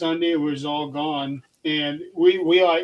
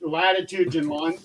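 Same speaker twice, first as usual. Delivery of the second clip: normal talking voice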